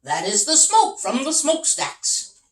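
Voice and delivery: a touch of a hearty captain; lightly voiced captain. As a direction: play the amused ship captain.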